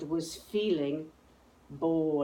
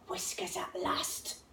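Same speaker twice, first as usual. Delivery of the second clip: hushed, breathless whisper; excited whisper; 11 semitones higher, 8 dB quieter than usual. A narrator's reading. Gruffalo's child spotting whiskers, an excited whisper. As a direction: whisper it as the prize appears.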